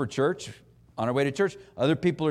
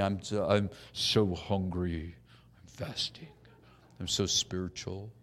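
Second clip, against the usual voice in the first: slow, whiny voice, very soft at the end; mock pious faster; 10 semitones lower, 7 dB quieter than usual. Preacher mocking a Pharisee, whining slowly and softly. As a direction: moan it like a showy faster fishing for pity. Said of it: "It was as though they were saying".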